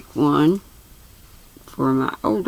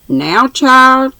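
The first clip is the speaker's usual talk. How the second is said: put-on high, loud call to a child; crying out; 8 semitones higher, 6 dB louder than usual. A narrator's reading. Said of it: "cried Missus Jarley".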